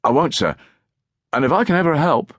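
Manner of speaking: put-on high eager boy voice cut short; eager urchin trailing. A narrator's reading. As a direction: rush the offer then let it die. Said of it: "voice died away".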